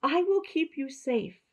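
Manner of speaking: shepherd calling to the lost lamb. Acted. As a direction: call out a promise to the lamb. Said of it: "the shepherd called out".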